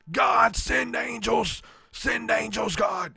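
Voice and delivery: preacher crying out to God at full voice, stretching out the word God; Lou Engle impression. Speaker imitating a preacher praying for angels, shouting high and slow. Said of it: "if I try to do Lou Engle now".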